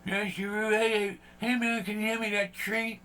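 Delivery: the buddy imagines the dog Gizmo talking. Retold or imitated. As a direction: play a small dog begging for a treat. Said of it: "if Gizmo could talk, he'd probably talk like this".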